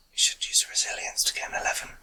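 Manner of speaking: quick, hushed aside; whispered hint. Real, not acted. Game master prompting a player to use resilience, quick whisper. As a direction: slip the advice in under your breath.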